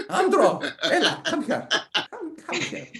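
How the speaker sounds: high-pitched mum scolding through laughter; imitating someone's mum. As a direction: summon the child as a cross mock mum. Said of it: "imagine his mum talking like that".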